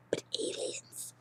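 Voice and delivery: breathy whispered aside. Real, not acted. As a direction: drop to a secret whisper. Whispered aside about aliens.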